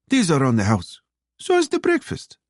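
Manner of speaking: high, lilting Russian waitress. A narrator's reading. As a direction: pitch it high as the kindly waitress. Waitress voiced in narrated dialogue.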